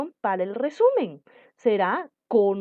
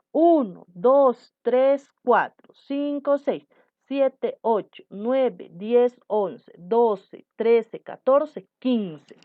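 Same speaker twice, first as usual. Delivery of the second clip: slow syllable count; 4 dB louder, much slower than usual. Shown as a demonstration. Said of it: "teacher counting syllables aloud".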